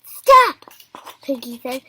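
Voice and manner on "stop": very high, loud yell; sharp command. A narrator's reading. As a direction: halt them with one shout. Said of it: "said as he kept spinning".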